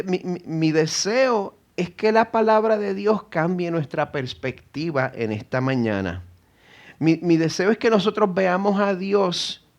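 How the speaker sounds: ordinary talk, nothing marked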